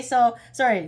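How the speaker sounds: normal talking voice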